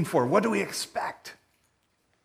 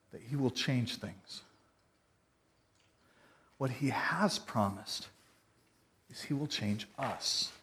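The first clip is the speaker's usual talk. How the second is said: earnest sermon; 4 semitones lower, 4 dB quieter than usual. Acted. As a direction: lower the voice and plead sincerely.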